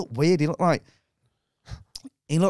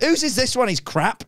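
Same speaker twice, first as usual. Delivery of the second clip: high-pitched comic outrage; mock indignant demand; 13 semitones higher than usual. Comic holding a kid up to the audience, mock indignant. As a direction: call out in exasperation, pitched up.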